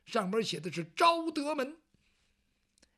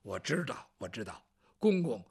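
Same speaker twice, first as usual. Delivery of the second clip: Bao Gong's deeper voice; 11 semitones lower than usual. Acted. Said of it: "包公一听".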